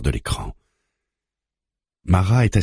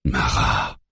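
calm murmur; 4 semitones lower than usual. A narrator's reading. Psychologist soothes Mara after her outburst, calm murmur. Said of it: "murmure-t-il d'une voix calme".

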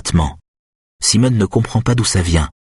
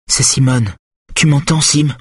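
higher woman's voice calling to a patient; Simone, anxious; 7 semitones higher than usual. Acted. Simone asks Schulman if he hears, anxious higher voice. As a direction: call gently but urgently to someone unconscious.

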